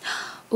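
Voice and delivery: soft gasp of surprise; hushed intake of breath; delighted gasp. Real, not acted. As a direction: gasp quietly at a find. Spots the next gift.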